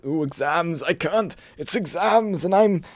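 high whiny mock excuse voice; whiny Max impression. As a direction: whine it like a sulky student skipping work. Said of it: "making an excuse".

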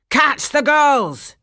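high, loud, slow pirate bellow; pirate yelling orders at his crew; pirate shout. A narrator's reading. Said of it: "shouted one".

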